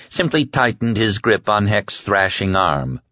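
plain reading voice

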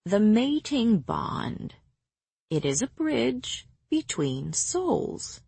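put-on slow and patient, as to a small child; talking to a child. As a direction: explain it gently and slowly. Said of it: "as if she were a small child".

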